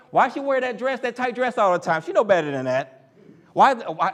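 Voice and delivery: loud, high mock complaining; a shrill-free but loud put-on gossip rant; mock office gossip. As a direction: ham up a catty coworker griping. Mocking gossip at water cooler.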